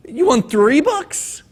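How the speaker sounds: a baffled librarian drawing it out; puzzled librarian. Acted.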